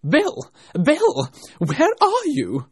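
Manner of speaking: high, loud angry calling; angry rabbit. A narrator's reading. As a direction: shout impatiently for a servant as an angry rabbit. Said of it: "the rabbit's angry voice".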